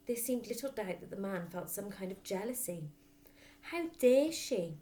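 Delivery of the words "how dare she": indignant father. A narrator's reading. Father's imagined jealous thought.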